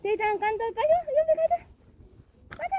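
high, loud call to come along. Real, not acted. Mother calling her pets along, high loud call.

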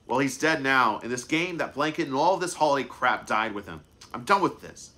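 loud, bitter grieving outburst; angry outburst. Acted. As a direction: lash out in grief. Brother lashing out in grief.

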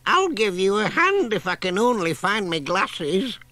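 put-on high, fluttery old lady voice; old granny voice. A narrator's reading. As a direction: play a dithery granny hunting her glasses.

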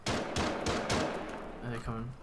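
hushed whisper, barely voiced; slow, tense whisper. Real, not acted. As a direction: whisper so the enemy can't hear. Hiding from enemies, sneaking.

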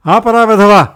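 high, very loud angry yell; furious outburst; shouted order. A narrator's reading. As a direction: bellow at someone to stop. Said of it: "అని అరిచాను".

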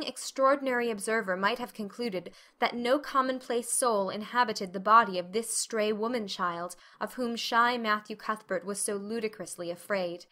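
plain reading voice

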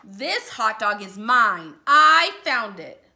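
snatch it back loudly; possessive shout. Acted. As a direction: pigeon yelling to the duckling about its hot dog. Pigeon claims the hot dog.

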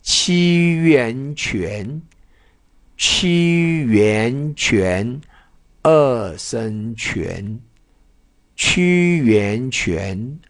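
七元泉 stretched very slowly, 七 and 元 blended into 泉 with a rising second tone; very slow spelling drill. Shown as a demonstration. Teacher spelling 泉 slowly for students. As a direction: drag out each part of 泉 for the learners. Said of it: "这怎么念？".

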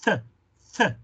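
"Th" blown through tongue and teeth; bare "th" sound, said alone. Shown as a demonstration. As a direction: isolate "th", tongue on teeth and blow. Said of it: "la lengua va a tocar el diente y vas a soplar".

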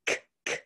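breathy unvoiced k, k, clipped; isolated k sound. Shown as a demonstration. Teacher isolating the last sound for students, unvoiced k. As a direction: model the final k sound alone. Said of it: "what sound is the last sound".

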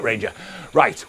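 ordinary talk, nothing marked

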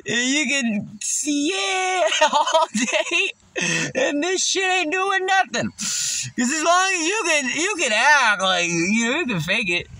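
high, slow words through giggles; stoned giggly rambling; laughing while talking. Real, not acted.

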